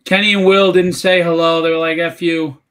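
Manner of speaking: loud, teasing call-out. Retold or imitated. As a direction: shout the message over to someone off mic. Calling a teasing message to wife.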